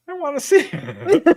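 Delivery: high, laughing eagerness; eager laughing. Retold or imitated. Telling her scared friend she wants to see it, high laughing eagerness. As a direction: giggle it like a kid who must look. She insists on chasing roar.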